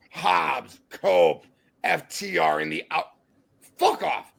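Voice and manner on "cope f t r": ordinary talk, nothing marked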